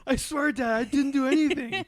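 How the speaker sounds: put-on very high, whining kid voice; whiny kid pleading. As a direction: squeak out a desperate denial. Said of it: "scare him into admission".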